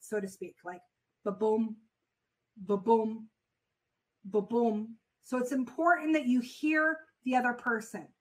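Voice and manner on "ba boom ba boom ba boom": heartbeat sound. Shown as a demonstration. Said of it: "same heartbeat".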